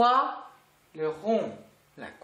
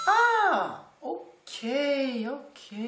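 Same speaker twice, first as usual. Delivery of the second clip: dawning realization; 7 semitones higher, much slower than usual. Acted. Teacher voicing pupils grasping the explanation, dawning realization. Acts out a student understanding the rule.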